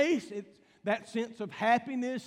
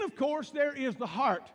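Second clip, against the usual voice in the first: ordinary talk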